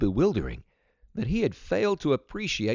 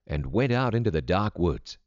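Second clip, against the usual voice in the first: ordinary delivery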